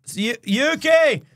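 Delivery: loud call across the house. Retold or imitated. Husband calling out to his wife, loud. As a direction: call her name loudly.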